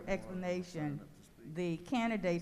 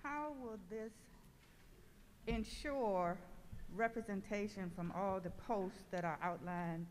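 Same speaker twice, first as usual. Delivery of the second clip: muffled, quiet and distant; 9 dB quieter than usual. Real, not acted. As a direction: speak as if through a hand over the mouth. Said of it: "you're a little muffled".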